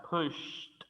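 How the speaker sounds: pushed said slowly, ending SH-T; pushed as "pusht", one syllable. Shown as a demonstration. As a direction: release a clear T after the SH of pushed. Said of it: "con una T al final".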